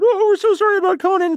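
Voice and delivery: high, sappy fake sympathy; hammed-up sorrow for the dead host; over-the-top fake condolence; mock mournful. Mocking a pity episode.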